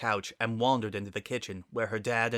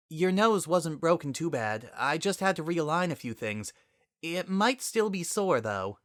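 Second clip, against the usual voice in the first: higher, kindly light voice; reassuring Twilight; 8 semitones higher than usual. Acted. Twilight telling Sunset about her nose, higher and kind. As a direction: soothe her patient while explaining.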